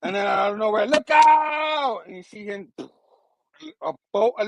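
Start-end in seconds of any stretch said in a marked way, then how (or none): 0.9-2.0 high, drawn-out cry of warning; mock warning shout. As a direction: call out a hammed-up alarm. Sudden warning shouted in comic retelling.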